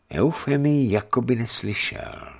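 plain reading voice

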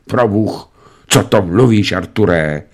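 plain reading voice

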